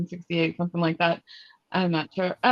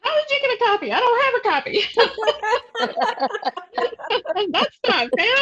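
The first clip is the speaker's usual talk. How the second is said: much higher, louder, laughing mock protest; excited squeal; 14 semitones higher, 4 dB louder, faster than usual. Real, not acted. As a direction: squeal it like a delighted friend. Playful envy over book copy.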